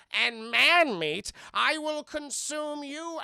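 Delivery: slow, loud villain threat; hammy Wesker menace. Retold or imitated. Wesker threatening to eat Alice, slow loud menace. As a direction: relish the threat like a hungry monster. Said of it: "apparently he's like".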